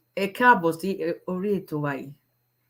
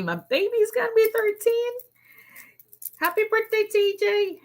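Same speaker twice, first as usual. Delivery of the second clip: gushing high voice. Real, not acted. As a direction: coo it up high like a proud mom. Proud mom gushing about son.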